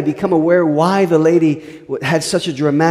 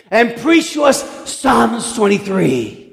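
put-on drunk's request; 6 semitones higher, slower than usual. Second drunk requesting a psalm.

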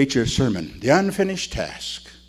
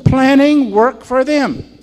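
"Planning" stressed, slow and higher; slow emphatic repeat; 9 semitones higher, 7 dB louder, much slower than usual. Shown as a demonstration. Preacher hammering the phrase home slowly. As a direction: weigh every word. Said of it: "That's what it says".

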